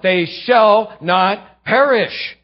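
"perish" drawn out slow and loud; slow emphatic "They shall not perish"; stress on perish. Shown as a demonstration. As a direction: slowly weigh each word, landing on "perish". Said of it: "leaving out".

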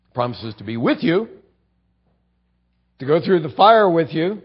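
ordinary talk, nothing marked

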